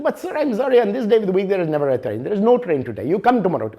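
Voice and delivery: high, apologetic Indian English clerk; the Indian English clerk says sorry but holds his line; Indian English accent. Retold or imitated. Clerk apologizes to stranded traveler, lightly voiced Indian English accent.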